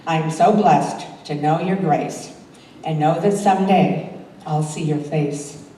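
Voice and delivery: ordinary talk, nothing marked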